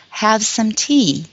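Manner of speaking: slow, deliberate demo; drawn-out teacher model; slow, clear model. Shown as a demonstration. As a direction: let each word ring slowly. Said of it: "listen until you hear my voice echoing".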